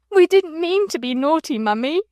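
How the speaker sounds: high, loud tearful plea to her mother; sobbing child. Acted. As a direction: cry it out, pleading. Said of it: "sobbed Bobby".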